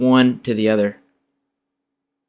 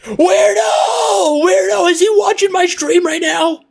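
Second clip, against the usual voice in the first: high frantic shriek; 4 dB louder than usual. Real, not acted. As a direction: screech it in disbelief. Freaked out by a stalker.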